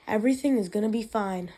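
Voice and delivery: plain reading voice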